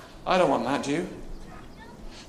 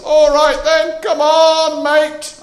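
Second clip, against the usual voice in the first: much higher, louder hearty call; Jesus calling Peter out onto the water; Jesus calling back; 12 semitones higher, 12 dB louder, much slower than usual. Retold or imitated. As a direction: shout it across the waves, cheerfully. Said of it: "and this voice comes back, says".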